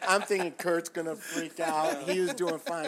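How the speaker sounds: loud, high-pitched telling; excited storytelling. Real, not acted. Medic telling co-hosts a funny call, loud and worked up. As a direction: raise the voice right up, carried away by the story.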